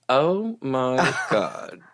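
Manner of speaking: slow, stretched-out exasperation with a laugh; laughing, long-suffering retold groan; mock exasperation. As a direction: draw out each word in amused disbelief. Retold exasperation at unsupportive partner.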